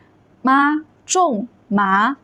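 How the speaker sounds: each syllable long and separate; drawn-out Mandarin model reading; very slow reading. Shown as a demonstration. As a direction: stretch out every tone for learners. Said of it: "I will read it very slow".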